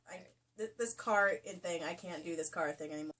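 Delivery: ordinary talk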